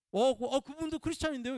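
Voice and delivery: high, loud startled objection; mock protest. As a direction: squeak out a defensive surprised protest. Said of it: "이렇게 얘기하면".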